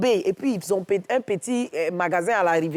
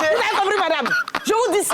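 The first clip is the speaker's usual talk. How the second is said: laughing scolding; 12 semitones higher, 4 dB louder, faster than usual. Retold or imitated. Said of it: "retold quarrel, laughing".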